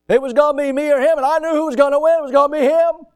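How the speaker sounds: excited storytelling. Retold or imitated. Preacher recalling facing a Malamute, loud excited rush. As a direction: build the tension of facing the dog. Climax of dog standoff tale.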